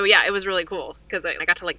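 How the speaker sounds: ordinary talk, nothing marked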